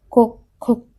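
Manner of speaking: a pointed little fake cough; aunt coughs on purpose to break up the couple's moment; deliberate fake cough. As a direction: cough on purpose to interrupt them. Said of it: "कविताताईंनी मुद्दाम खोकल्याचा आवाज केला".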